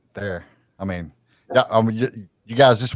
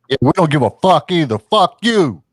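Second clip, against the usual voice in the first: mock yelling; 6 semitones higher, 4 dB louder than usual. Jokingly cussing back.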